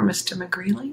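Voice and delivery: ordinary delivery, nothing marked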